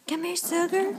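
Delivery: high sing-song call to the pet. Real, not acted. Owner calling her pet sugar, high sing-song coax.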